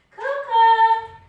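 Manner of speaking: loud, high, sung-out call; long yelled name for the dog; shouting the dog's name. Real, not acted. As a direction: call the dog from across the house.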